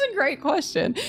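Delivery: high, laugh-filled reply. Real, not acted. Reader amused by the hand-holding question, laughing. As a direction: talk through the laughter.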